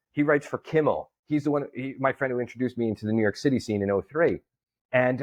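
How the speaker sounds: ordinary delivery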